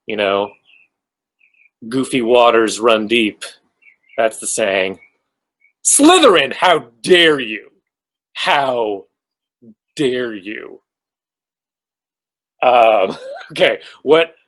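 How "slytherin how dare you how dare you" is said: mock outrage. Mock outrage at viewer's house guess.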